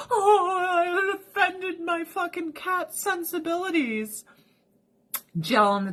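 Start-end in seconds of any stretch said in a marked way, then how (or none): 0.1-4.2 high, slow mock-offended cat. Owner voicing cats offended by the mat, high and slow. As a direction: sound prissy and wounded. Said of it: "they're like".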